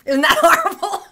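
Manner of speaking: high, loud, laughing through the words. Real, not acted. Woman admits leaving wet towels for Barry, laughing. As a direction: burst out laughing as you say it.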